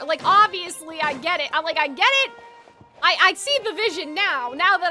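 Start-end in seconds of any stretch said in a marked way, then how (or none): none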